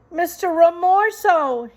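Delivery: high, loud call into the dark; shouted. A narrator's reading. Mouse shouts to the rat, high and loud. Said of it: "he shouted".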